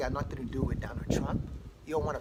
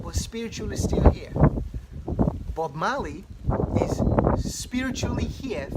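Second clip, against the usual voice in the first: loud, climbing sermon cry; preaching at full force; 2 semitones higher, 9 dB louder than usual. Acted. Preacher crying out to the congregation about spirit, loud. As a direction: preach it out to the whole room.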